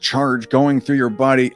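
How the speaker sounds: usual speaking voice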